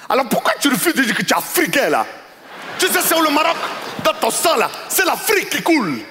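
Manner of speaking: put-on high, emphatic African accent lecture; mock indignant customer, high-pitched. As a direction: lecture him about his African blood.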